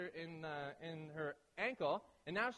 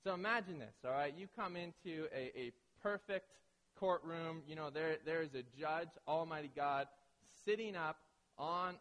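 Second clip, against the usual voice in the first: ordinary talk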